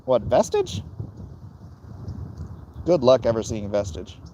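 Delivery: ordinary talk, nothing marked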